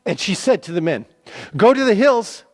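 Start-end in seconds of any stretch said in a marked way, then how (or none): 1.6-2.4 high, loud urgent warning; urgent call. Acted. Rahab urging the hiding men to flee, loud and urgent. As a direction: push them to run for the hills.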